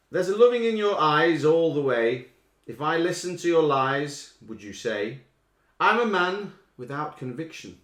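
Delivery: slow, loud declaimed lines; slow lyric reading. Acted. Quizmaster reading lyrics loudly for the listeners. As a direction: read the words out slowly and loudly.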